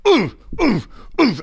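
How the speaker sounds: high yelping oohs while hitting the bags; loud pained ooh cries. Shown as a demonstration. Comic telling you to scream at the bags, yelping oohs. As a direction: yelp it out high like it hurts. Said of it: "scream real loud".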